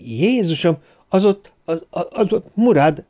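high, stammering fright; acted shocked cry on recognising someone; terrified exclamation. A narrator's reading. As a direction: stumble over the words in horror. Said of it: "Timmons rémülten felkiáltott".

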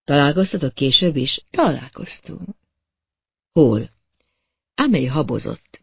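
plain reading voice

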